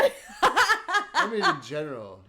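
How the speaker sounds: high laughing, slowed down. Real, not acted. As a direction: talk through a big laugh. Laughing at her comeback.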